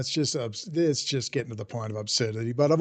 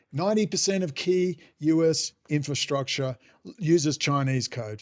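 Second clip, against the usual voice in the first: ordinary delivery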